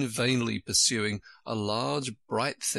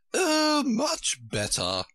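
delighted cry; much slower than usual. A narrator's reading. Queen answering Alice about her finger, crying out. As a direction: cry out high on the first word, then linger. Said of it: "cried the Queen".

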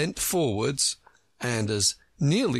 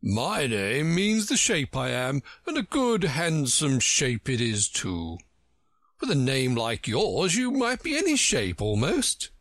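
Humpty Dumpty, self-satisfied; 4 semitones higher than usual. Acted. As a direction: boast grandly, stretching the words.

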